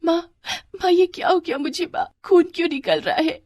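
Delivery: high, tearful wail to mother; acted little-girl weeping; sobbing. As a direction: cry to your mother between sobs. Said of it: "सिसक सिसक कर रोने लगी".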